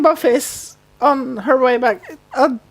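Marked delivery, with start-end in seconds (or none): none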